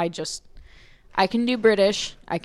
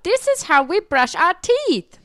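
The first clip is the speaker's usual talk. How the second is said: high, slow, cute little robot voice; sweet slow robot character; cute BMO voice; 10 semitones higher, 4 dB louder than usual. Retold or imitated. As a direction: squeak it out like a tiny cartoon robot. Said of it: "Adventure Time".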